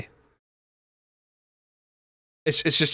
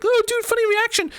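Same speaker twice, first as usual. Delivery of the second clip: much higher, louder sarcastic squeal; sarcastic squeaky mock voice; mocking impression; 5 dB louder than usual. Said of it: "and it's like".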